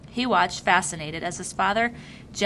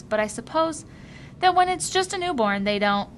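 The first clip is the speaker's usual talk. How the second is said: put-on higher boyish voice, wondering; young Jonas; 9 semitones higher than usual. A narrator's reading. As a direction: think it through as the boy. Jonas continues musing, voiced higher.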